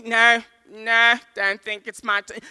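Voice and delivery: acted anxious refusal; panicked refusal. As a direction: balk in a flustered panic. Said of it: "We're panicking at the robots".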